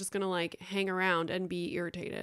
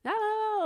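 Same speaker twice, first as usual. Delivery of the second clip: high sing-song la la; kid's noise; 14 semitones higher than usual. Retold or imitated. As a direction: mimic a noisy toddler's la la la. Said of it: "making all the noises, like, nonstop".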